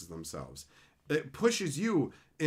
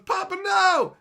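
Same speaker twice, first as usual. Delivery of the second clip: put-on high, loud kid's cry; child crying out; 8 dB louder than usual. Child sacrificing himself for his papa, shrieking. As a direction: mock-desperate child shrieking.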